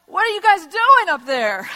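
loud, high shout up at the roof; mock-outraged holler; indignant yell. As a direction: act out an outraged homeowner calling up. Homeowner yells up at roof-breakers.

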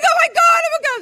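very high, loud shriek of joy; ecstatic screaming. Retold or imitated. Woman learning she'll be a mother, ecstatic screaming. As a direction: scream it, overcome with the news. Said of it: "I'm screaming".

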